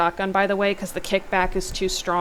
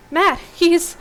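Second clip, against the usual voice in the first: panicked; 10 semitones higher, 9 dB louder than usual. Acted. Riley cut off in panic.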